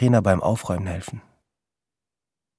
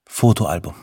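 plain reading voice

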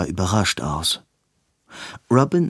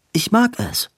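Robin's eager voice; 11 semitones higher than usual. Acted. Robin reassures Edwin in bed.

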